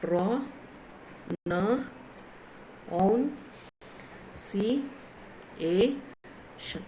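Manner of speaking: isolated sounds with long pauses between; slow, segmented sounding-out; syllable by syllable. Shown as a demonstration. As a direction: count out each syllable on its own. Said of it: "how many sounds are there?".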